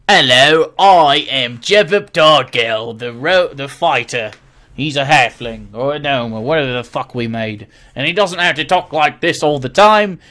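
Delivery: loud, hammed-up halfling fighter voice; character voice. Shown as a demonstration. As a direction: put on an over-the-top role-play character voice. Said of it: "talk in your character's voice".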